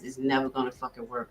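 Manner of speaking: ordinary delivery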